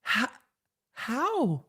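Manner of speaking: high stunned squeak of disbelief; a squeaky shocked how; high disbelieving how. Retold or imitated. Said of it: "I'm like".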